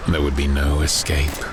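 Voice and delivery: usual speaking voice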